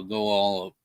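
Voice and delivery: ordinary delivery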